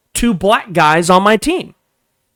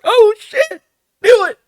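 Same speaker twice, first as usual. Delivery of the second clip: very high falsetto squeal; goading in a tiny high-pitched voice; gleeful egging on; 4 dB louder than usual. Retold or imitated. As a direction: mimic an excited onlooker, squeaky high. Acts out egging the man on.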